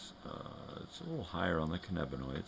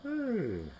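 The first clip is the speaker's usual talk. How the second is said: very high, squeaky little "Hey". Real, not acted. Owner greeting the dog with a high squeaky hey.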